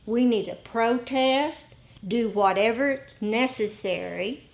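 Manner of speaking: ordinary talk, nothing marked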